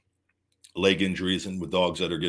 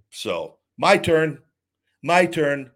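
slow, loud and higher, hammering each word; defiant vow; 7 semitones higher, 7 dB louder, much slower than usual. Real, not acted. Vowing payback to his enemies, slow loud defiance. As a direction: drag it out like a threat. Vowing revenge mid-rant.

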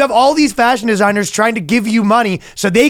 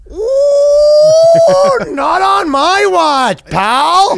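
put-on high shouted Canadian accent, slow; shouted mock Canadian accent defending a colleague; super Canadian; 14 semitones higher, 4 dB louder, much slower than usual. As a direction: bellow it like an outraged Canuck. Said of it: "he goes super Canadian".

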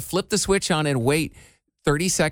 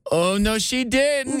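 drawn-out, high-pitched mock outrage; mock reality-show sound effect; 7 semitones higher, much slower than usual. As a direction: imitate a scandalized reaction, higher and slow. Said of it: "that weird sound effect".

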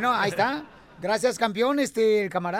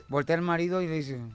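ordinary talk, nothing marked

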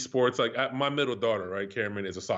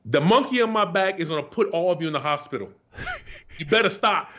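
louder, higher threatening shout; retold threat yell; 5 semitones higher, 5 dB louder than usual. Telling the pickup players about the monkey, a retold threat yell. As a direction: bark it at the whole court. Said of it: "I was screaming this to people".

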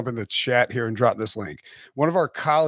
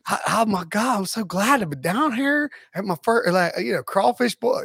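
Brian Kelly; 6 semitones higher than usual. Retold or imitated. Coach pretending he belongs down here, fake Southern accent. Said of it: "fake Southern accent".

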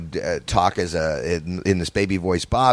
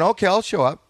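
high put-on voice; 10 semitones higher than usual. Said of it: "so I went".

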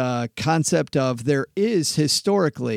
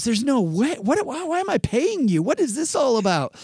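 much higher, indignant put-on client voice; outraged client Sandy; 10 semitones higher than usual. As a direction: protest like a shocked client. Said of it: "And Sandy goes".

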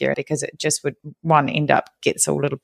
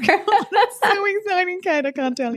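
high, slow laughing squeal; laughing excitedly; 12 semitones higher than usual. Real, not acted. Mock excitement over badges.

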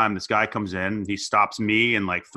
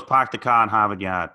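mock Boston accent with dropped R's; hammed-up Boston accent; 4 dB louder than usual. Shown as a demonstration. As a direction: recite it like a cliché Boston local. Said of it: "I can't do a Boston accent".

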